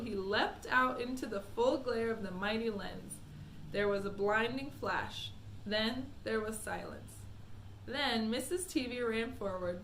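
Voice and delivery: plain reading voice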